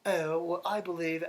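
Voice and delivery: put-on high, affected European accent; drawn-out fake European accent, asking mom politely; [name]. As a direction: play a son putting on a European accent. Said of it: "why are you talking like that?".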